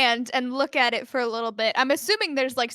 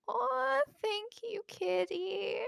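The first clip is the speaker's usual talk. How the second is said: much higher, softer cooing; a girl cooing at her little cat; tender thanks; 9 semitones higher, 8 dB quieter, slower than usual. Acted.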